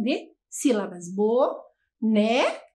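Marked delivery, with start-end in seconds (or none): 1.1-2.6 Boneca slowly, bo-ne-ca beat by beat; split into syllables. Shown as a demonstration. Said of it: "Quantas vezes?". (Boneca devagar, sílaba por sílaba)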